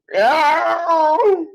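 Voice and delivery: high cat meow. Acted. Acting as a kitty cat.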